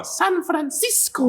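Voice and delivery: high, slow, drawn-out cartoon kid voice; squeaky little-boy voice with a hammed-up accent; Tails. Shown as a demonstration. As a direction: stretch it out high, like a silly sidekick. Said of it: "I would say Tails was from".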